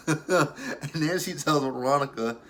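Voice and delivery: amused, laughing into the words. Real, not acted. Recapper telling viewers what Quita told Veronica, laughing. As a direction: start the line through a laugh. Cracked up retelling the scene.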